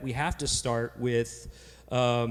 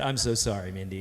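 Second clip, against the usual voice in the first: softer, hammed-up heartfelt sorry; mock sincere apology; 7 semitones lower, 5 dB quieter than usual. Cousin to Mindy, a softer mock-sincere apology. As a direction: lay it on thick with a hushed apology. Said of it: "So I looked at her and I said".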